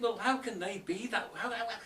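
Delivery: high, laughing question; puzzled laughing how; amused disbelief. Acted. Bewildered listener asks.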